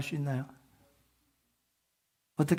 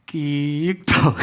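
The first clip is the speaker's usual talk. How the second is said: drawn-out mock creak, very slow; creaking sound; 4 dB louder, much slower than usual. Pastor imitating the shed creaking at night, slow mock creak. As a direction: stretch the creak out like old tin in the wind. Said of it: "이런 소리가 나요".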